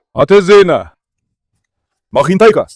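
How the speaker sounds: loud call to wait. Real, not acted. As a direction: shout across the yard. Calling the dog to wait. (malakas na pagtawag)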